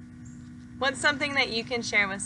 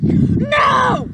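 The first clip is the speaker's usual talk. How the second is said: loud, much higher outraged shout; the pigeon explodes in frustration; 13 semitones higher, 10 dB louder than usual. Acted. Pigeon answers his own question.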